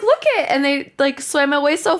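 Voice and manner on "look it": high, loud pointing shout; excited call. Retold or imitated. Spotting big fish in the water, high excited call. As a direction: call out to the others, thrilled. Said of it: "and then I was like".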